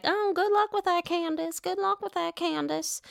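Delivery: a high put-on voice throwing shade; mock Mama Dorothy. Mother doubting her daughter's plan, high mocking imitation. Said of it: "you know how Mama Dorothy feels about that".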